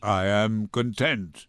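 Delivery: low, slow, defeated; broken old man. Acted. Shylock defeated in court.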